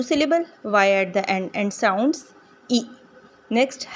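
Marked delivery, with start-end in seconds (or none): none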